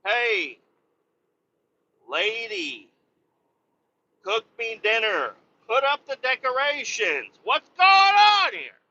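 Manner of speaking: high, mock macho demands, slowing at the end; mock yelling at the wife for dinner; mock bossy husband. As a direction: ham up a bossy man of the house. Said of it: "maybe I just need to yell at the wife".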